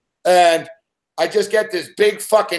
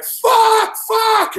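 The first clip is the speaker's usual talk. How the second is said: very high, loud whooping curse; elated shouting; 4 dB louder than usual. Retold or imitated. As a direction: cheer like you won the Super Bowl. Crowd celebrating a win.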